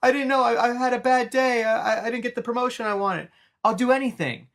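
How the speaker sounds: mock terrified apology; panicked pleading. Charlie begging the Joker for mercy, mock panic. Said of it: "and he's like".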